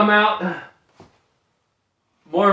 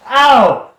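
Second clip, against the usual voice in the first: yelp of pain; 4 semitones higher, 8 dB louder than usual. Real, not acted. Hurt outcry.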